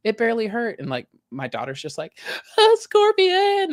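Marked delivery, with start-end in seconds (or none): 0.0-0.8 higher, breezy put-on nonchalance; dad brushing off the sting to calm his kids; playing it cool. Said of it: "playing it cool". 2.6-3.7 put-on very high, loud, drawn-out child's shriek, slow; panicked little girl. Daughter freaking out in pool.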